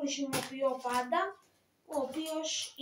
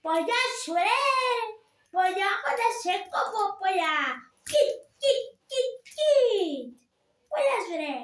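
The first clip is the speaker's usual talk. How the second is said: loud, much higher blustering strongman; Turkish strongman voice; 6 semitones higher, 5 dB louder than usual. Shown as a demonstration. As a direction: bluster and squeal like the puppet bully. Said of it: "και η φωνή". (δυνατή, πολύ ψηλή φωνή του μασίστα)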